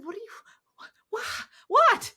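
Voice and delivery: stunned by the pastor's laughter; baffled. Retold or imitated. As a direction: squeak out confusion. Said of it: "I, I said".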